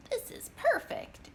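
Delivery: excited, up high; delighted exclamation, pitched high. A narrator's reading. Mo jumps into the old rowboat, excited and high. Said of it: "exclaimed Mo".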